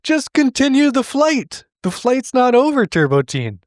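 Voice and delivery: high-pitched, exasperated pleading; comic exasperated protest; mock exasperation. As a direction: scold the hero loudly in a high, incredulous voice. Scolds cartoon hero in mock exasperation.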